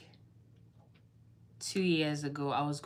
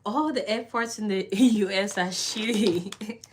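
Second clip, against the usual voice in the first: louder, higher, laughing jab at US airports; mock complaint; 4 semitones higher, 7 dB louder than usual. Traveller back home mocking US airports, laughing gripe. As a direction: toss off a cheeky gripe with a laugh. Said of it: "I was like".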